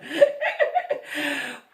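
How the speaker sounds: loud, high burst of laughter; amused cackle; real laugh. Real, not acted. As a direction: crack up mid-story. Laughing at own story.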